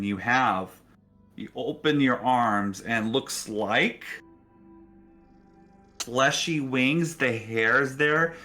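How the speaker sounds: ordinary delivery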